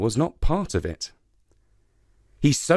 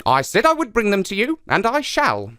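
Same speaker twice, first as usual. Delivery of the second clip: fast, high spider voice; a touch of brash confidence; confident; 8 semitones higher than usual. Acted. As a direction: promise it cockily. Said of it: "with great confidence".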